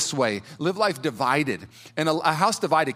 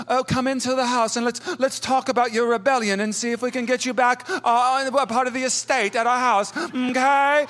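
higher-pitched mock British posh gentleman; posh British accent; 8 semitones higher than usual. Posh British father welcoming his prodigal son, hammed-up accent. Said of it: "like, British posh guy who goes".

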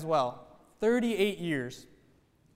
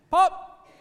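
popping tire; 10 semitones higher, 5 dB louder than usual. Retold or imitated. Tire bursting in the story.

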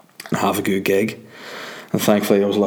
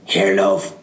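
lightly voiced local, slower and drawn-out; rough Northern Irish local; slower than usual. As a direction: put on a hint of a coarse local accent. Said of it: "They're more like".

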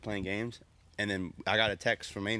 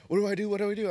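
high, louder frantic friend; imitated panic; 9 semitones higher, 4 dB louder, much faster than usual. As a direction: mimic a friend panicking on the spot.

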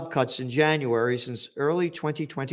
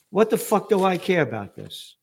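ordinary talk

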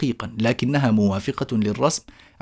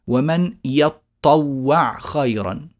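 slow recitation; much slower than usual. Shown as a demonstration. Teacher reciting Quran readings.

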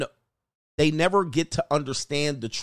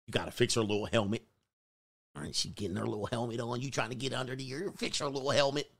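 lower, soft and quick; hushed fast aside; 5 semitones lower, 9 dB quieter, faster than usual. Retold or imitated. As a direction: rattle it off under your breath. Describing sister with helmet.

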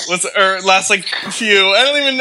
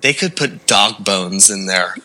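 low slow emphasis; 4 semitones lower, slower than usual. Real, not acted. Gross exaggeration, low drawn.

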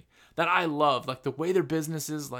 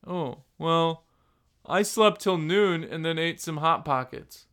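a slowed, mocking lazy slacker voice; slower than usual. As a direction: lazily imitate a guy who slept till noon. Mocking an unmotivated guy.

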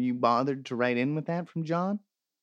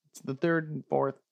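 ordinary talk

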